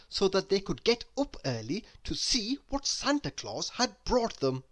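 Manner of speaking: usual speaking voice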